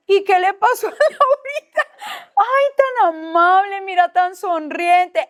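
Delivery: imitando halagos, muy agudo y alargado; gente de iglesia asombrada. Retold or imitated. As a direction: imita a la gente de la iglesia, maravillada con la niña. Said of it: "Y en la iglesia me decían". (asombro agudo y mimoso imitado)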